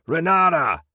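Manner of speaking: high, loud call of her name; shout. A narrator's reading. Narrator shouts for Renata in the silent prison, loud call. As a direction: call out across the cells. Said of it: "I shouted once more".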